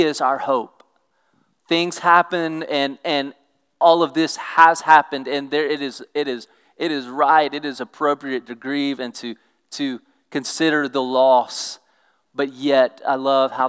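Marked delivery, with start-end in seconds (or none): none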